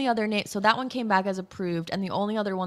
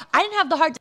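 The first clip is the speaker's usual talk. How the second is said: normal talking voice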